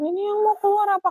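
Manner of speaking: high, quick mock-fearful question; imitated scared child. Toddler on the toilet, high imitated fright. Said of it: "terus sampai ketakutan".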